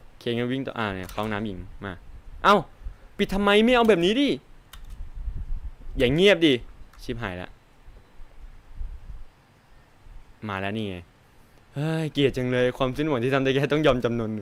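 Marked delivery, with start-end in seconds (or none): 2.4-6.5 yelling in real fright at the closed door; panicked yell. Real, not acted. Streamer trapped by the alien, yelling in panic. Door shuts, monster chasing him. 7.0-11.0 hushed dread. Real, not acted. Monster arrives, resigned dread.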